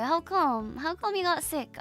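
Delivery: ordinary talk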